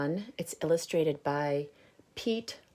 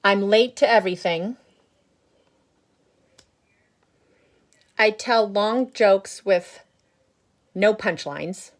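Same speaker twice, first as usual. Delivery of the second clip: loud, higher, grumbling seed voice; grumpy bad seed; 4 semitones higher, 12 dB louder than usual. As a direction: boast about being bad, loud. Reading the seed's lines aloud.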